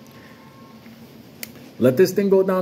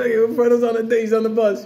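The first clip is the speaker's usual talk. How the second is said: retold yelling; 5 semitones higher than usual. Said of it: "We were screaming".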